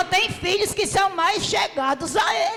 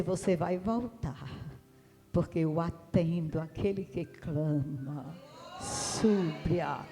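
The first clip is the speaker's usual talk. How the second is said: low, quiet voice of the Lord; 10 semitones lower, 10 dB quieter, slower than usual. Retold or imitated. God sends her back, low and quiet. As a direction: speak softly and gravely as God. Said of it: "Aí o Senhor disse".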